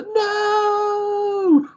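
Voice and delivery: long, high wailed "no"; imitated wail of a bereaved parent; anguished wail. Shown as a demonstration. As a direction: stretch it into a high, grieving wail. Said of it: "just the wail of it".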